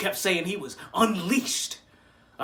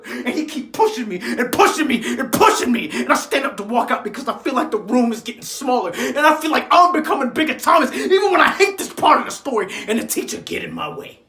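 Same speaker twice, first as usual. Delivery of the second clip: loud, driving, cornered urgency; mounting agitation; 2 semitones higher, 5 dB louder than usual. Acted. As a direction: pile it up as the pressure closes in. Pressure mounting toward the fight.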